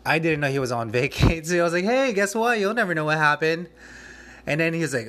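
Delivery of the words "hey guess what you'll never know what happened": forced cheer. Retold or imitated. Calling director with bad news.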